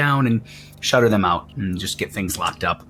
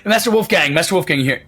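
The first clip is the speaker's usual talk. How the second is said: calling out; 6 semitones higher, faster than usual. Acted. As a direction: call for the master over the crowd. Carny calling for the master.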